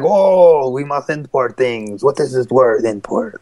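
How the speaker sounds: hammed-up Japanese accent, drawn-out opening; clumsy caricature of a Japanese accent; terrible Japanese accent. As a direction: put on a bad mock-Japanese accent. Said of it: "That's a terrible accent".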